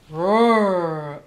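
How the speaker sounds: a loud growling roar; bear growl. Acted. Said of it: "Old Bear growled".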